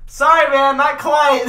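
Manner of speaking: loud, high call back to the next car; shouted reply. Retold or imitated. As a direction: re-enact hollering an apology. Shouting back between cars.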